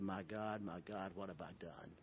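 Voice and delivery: hushed dismay. Retold or imitated. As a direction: breathe it out, stunned at himself. Realising his mistake, shocked.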